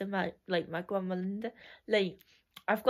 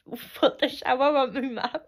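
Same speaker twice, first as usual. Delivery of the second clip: words broken up by real laughter; laughing through it; 4 semitones higher, 5 dB louder than usual. Real, not acted. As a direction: giggle while telling the funny bit. Funny memory told while laughing.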